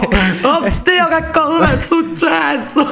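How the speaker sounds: very high, slow panicked squeal; mock panic. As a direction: play it as a hammed-up panic. Mock panic over lost microphone. (panico esagerato, voce acutissima)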